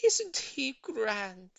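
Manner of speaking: very slow, high and proud. A narrator's reading. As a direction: gush over the pet.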